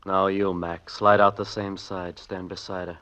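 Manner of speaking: low, soft, cold commands; low menacing order. Acted. Gunman quietly marching hostages to the door, menacing. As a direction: keep it low and threatening.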